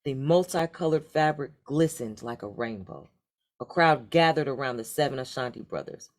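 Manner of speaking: plain reading voice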